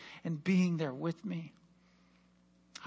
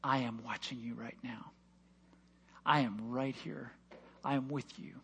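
low, soft, tender assurance; God's gentle voice; 8 semitones lower, 10 dB quieter than usual. Pretend. Preacher voicing God comforting him. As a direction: speak as a loving father, quietly. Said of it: "And he said".

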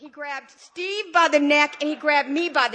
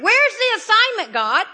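louder and higher, throwing the question upward; exasperated cry to God; 7 semitones higher, 6 dB louder than usual. Retold or imitated. Asking God loudly for her assignment, exasperated. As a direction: demand it of the sky, frustrated.

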